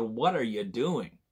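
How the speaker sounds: normal talking voice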